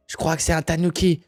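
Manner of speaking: ordinary talk, nothing marked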